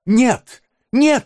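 put-on high, loud frightened boy; terrible alarm. A narrator's reading. Oliver denying the stranger, alarmed cry. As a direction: cry out in panic. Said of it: "воскликнул Оливер в страшной тревоге".

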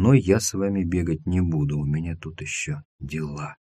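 lightly hushed, sinking low and soft; whispered. A narrator's reading. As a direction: let it fade down to a near-private murmur. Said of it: "прошептал Тим".

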